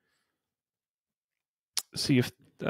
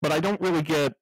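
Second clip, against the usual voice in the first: cop radio voice; 3 semitones higher than usual. Pretend. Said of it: "this one's called Cop".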